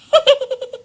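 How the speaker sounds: high-pitched insane giggle; loud manic weasel cackle; Psycho. Shown as a demonstration. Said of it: "Some kind of insane laugh".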